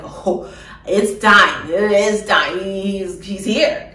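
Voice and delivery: loud, slow wonder at the moment; amazed. Retold or imitated. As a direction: announce it, awestruck. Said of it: "I'm so amazed".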